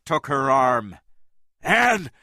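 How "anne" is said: high, loud pleading warning; urgent warning. A narrator's reading. Jack holding Anne back, a high urgent warning. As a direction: hold her back with your voice.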